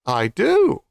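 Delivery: high, eager little crow; preen, showing off your voice; proud crow. Acted.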